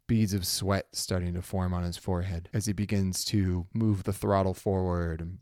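normal talking voice

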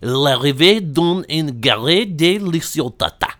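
put-on drunk-sounding French accent. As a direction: announce it in over-the-top French. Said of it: "in the best French voice that I can".

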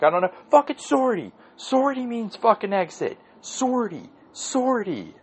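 very high mock rant; hammed-up meltdown, voice pushed way up; Bill O'Reilly imitation. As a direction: squawk it in comic exasperation. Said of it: "pulled the Bill O'Reilly thing".

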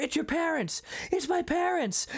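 high-pitched alarmed warning; mock panic. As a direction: act out a kid caught by parents. Parents arrive, retold panic.

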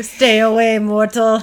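loud, half-hearted attempt at a Dracula accent; badly faked vampire accent, held on one level; failed Dracula accent. Shown as a demonstration. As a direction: try a Hungarian vampire accent and miss. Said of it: "I can't do it".

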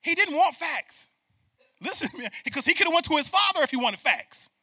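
loud, high preaching, driving the point; preaching at full force. Real, not acted. Pastor preaching hard to the congregation, loud and high.

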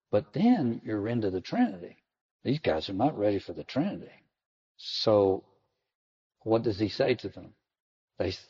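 normal talking voice

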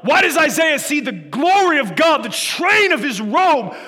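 shouted, high, impassioned sermon; full-force preaching. Real, not acted. Preacher asks why Isaiah saw God's glory, impassioned shouting. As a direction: thunder out the questions at full voice.

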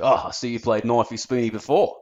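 broad Australian Ocker twang; Ocker accent. Shown as a demonstration. Redoing the pub man's line, broad Ocker accent. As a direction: sell the joke with an Ocker accent. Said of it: "it's that kind of accent".

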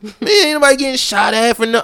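loud, high mock confrontation; officer imitating a heckler at an arrest; heckling bystander. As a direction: play an angry young guy yelling at the cops. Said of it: "I was like".

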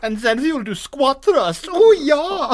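high, mock Austrian bodybuilder accent, building to a loud cheer; Hans and Franz. Continuing co-host's bodybuilder bit, hammed-up Austrian accent. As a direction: pump it up like an Austrian strongman. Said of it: "We are, we are Hans and Franz".

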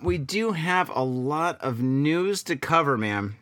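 slow, stretched-out announcement; leisurely, elongated lead-in to the news; drawn-out segue. Real, not acted. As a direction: draw the words out as you change topic. Drawn-out segue, then repeated quickly.

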